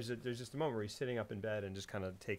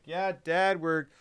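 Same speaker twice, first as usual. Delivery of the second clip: raised, louder son's voice; voiced son; 6 semitones higher, 8 dB louder, much slower than usual. Son talking to his dad, high and loud. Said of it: "so the son's like".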